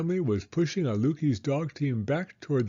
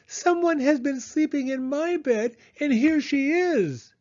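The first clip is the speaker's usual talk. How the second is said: put-on squeaking Baby Bear; 13 semitones higher, 4 dB louder than usual. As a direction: squeak it like an excited tiny cub.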